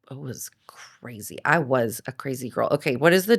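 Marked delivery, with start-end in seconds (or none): 0.1-1.4 breathy whisper, half to herself; whispered aside. Real, not acted. Admitting her crazy past in a hushed whisper. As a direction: drop to a secret whisper.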